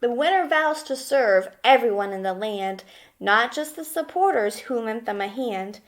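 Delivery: normal talking voice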